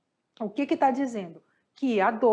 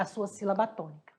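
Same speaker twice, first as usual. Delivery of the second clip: ordinary talk, nothing marked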